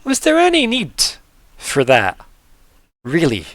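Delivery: starts high and loud, falling away in pauses; grumpy woken sloth. Acted. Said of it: "moves his hat off ... and kinda goes".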